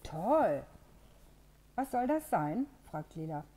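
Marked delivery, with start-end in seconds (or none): 1.8-2.6 puzzled. Acted. Lela puzzled by the contraption.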